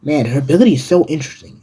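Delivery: usual speaking voice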